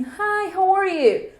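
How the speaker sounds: animated, high-pitched greeting; nativo cumprimentando. Retold or imitated. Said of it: "chegou pra mim e falou assim". (cumprimento de nativo, animado e agudo)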